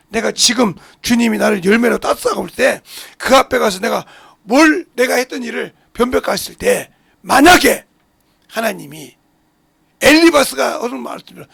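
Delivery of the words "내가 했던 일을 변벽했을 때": normal talking voice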